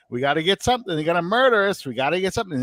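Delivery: lightly imitated high-pitched coach; Hank Stram impression. Imitating the coach fretting during the game, a little high-pitched voice. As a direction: raise the pitch for a mock worried coach. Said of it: "that little high-pitched voice".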